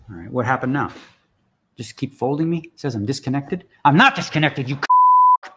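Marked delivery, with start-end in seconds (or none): 3.8-5.5 a real shout, cut off mid-swear; angry yell. Real, not acted. Streamer shouting at the software over a false disconnect, furious. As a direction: yell it at the machine. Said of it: "both dogs heard me yell".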